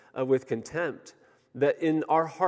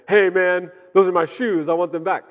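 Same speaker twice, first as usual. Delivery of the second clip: loud, would-be menacing tough guy; trying to sound scary; 6 semitones higher, 8 dB louder than usual. Retold or imitated. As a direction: puff up and sound threatening. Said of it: "I was trying to sound scary".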